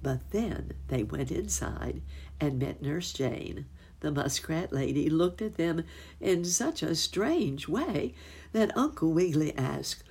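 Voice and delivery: ordinary delivery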